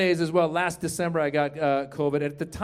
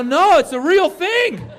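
high, loud, drawn-out protest; exasperated comic insistence, pitched up; insistent retort; 8 semitones higher, 5 dB louder, slower than usual. Retold or imitated. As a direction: insist loudly, stretching the words. Indignant retold reply to youngster.

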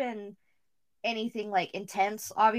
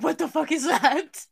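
higher, louder startled reaction; mock alarm; 7 semitones higher, 5 dB louder than usual. Recounting ghost sightings to a friend, mock alarm. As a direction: act out a startled outburst for laughs.

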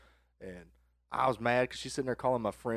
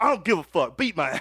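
loud, raised-pitch defiance; defiant retort; 7 semitones higher, 10 dB louder than usual. Retold or imitated. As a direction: re-enact a kid daring dad to punish him. Defiant kid answers dad.

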